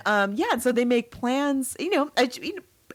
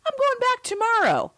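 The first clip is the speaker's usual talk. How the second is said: mock husband. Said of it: "he's like".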